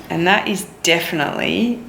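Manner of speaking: ordinary delivery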